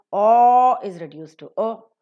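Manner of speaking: long loud "O", then a short weak "O"; long then weak vowel. Shown as a demonstration. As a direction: hold the first "O", clip the second "O". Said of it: "long or the strong vowels are reduced".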